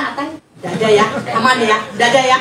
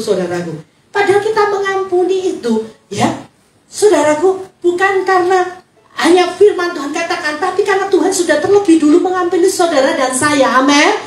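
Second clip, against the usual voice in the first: normal talking voice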